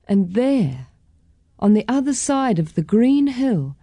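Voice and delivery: ordinary delivery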